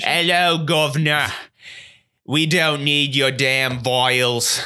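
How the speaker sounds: hammed-up British accent, slow at the end; a playful mock British gent. Asked by co-host to do British, mock British accent. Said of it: "can you say this all in a British accent".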